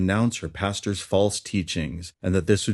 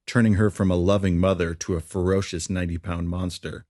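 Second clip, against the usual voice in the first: ordinary delivery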